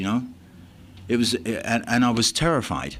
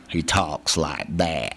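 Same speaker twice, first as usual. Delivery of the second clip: slow, lower Texan drawl; 5 semitones lower, much slower than usual. Retold or imitated. Speaker shows how his Texan friend talks, slow Texan drawl. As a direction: imitate a Texan, stretching every word. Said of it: "he's got that Texan drawl".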